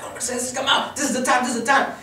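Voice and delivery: urging her up like the crowd; excited crowd call. Retold or imitated. Crowd urging Mercedes to stand, excited call. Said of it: "It's like".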